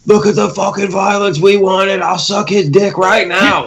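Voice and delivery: high, slow drooling fanboy; mock the fans gushing. Mocking fans gushing over violence.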